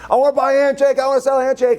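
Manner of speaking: high-pitched mock trading-floor yell; imitated student yelling. Students calling out trades in a market game, mock yelling. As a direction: imitate students hawking wares, high and insistent. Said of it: "They're yelling, they're screaming".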